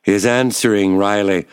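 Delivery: plain reading voice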